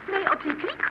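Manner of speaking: offended, high and loud at the start; indignant, then settling; indignant outburst. Acted. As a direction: take sharp offence at the insult. Maid offended at being called names.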